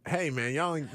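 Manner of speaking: usual speaking voice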